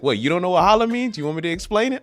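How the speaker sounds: high-pitched mock incredulity; taunting mom. Mom challenging her kid about slang, mock incredulous.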